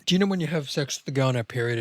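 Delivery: normal talking voice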